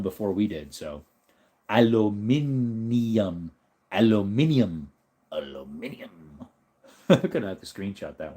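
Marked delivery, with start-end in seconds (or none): 1.7-3.5 British pronunciation. Retold or imitated. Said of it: "English mispronounce aluminum". 3.9-4.9 British pronunciation. Retold or imitated. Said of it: "English mispronounce aluminum". 5.3-6.5 British pronunciation. Retold or imitated. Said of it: "English mispronounce aluminum".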